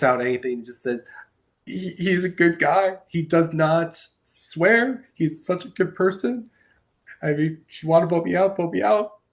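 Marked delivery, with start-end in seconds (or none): 1.7-9.2 retold tearful plea, pitched higher; mock breaking voice as he chokes out praise; imitating Will crying. Will's eviction speech to the house, imitated higher and tearful. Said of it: "He is crying hard".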